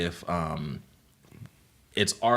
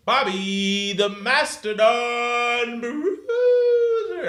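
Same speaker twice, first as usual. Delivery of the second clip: wrestling announcer; 5 dB louder, much slower than usual. Pretend. Wrestler ring name announcement.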